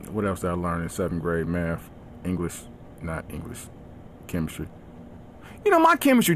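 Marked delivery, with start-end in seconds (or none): 0.1-4.7 much softer, lower tired trailing off; defeated mumble. Retold or imitated. Worn-out teacher assigning a quiz, soft defeated sigh. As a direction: give up mid-sentence, deflated.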